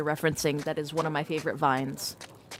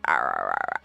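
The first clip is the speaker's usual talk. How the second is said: very low, creaky pirate growl; vocal fry growl. Shown as a demonstration. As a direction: grind it out deep in the throat. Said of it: "I can add more vocal fry".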